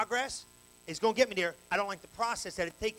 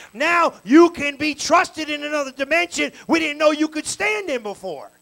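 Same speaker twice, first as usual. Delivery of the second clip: louder and louder preaching, near a shout; preaching climax; 2 semitones higher, 10 dB louder than usual. Acted. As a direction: build to a rousing preacher's peak. Sermon rising to climax.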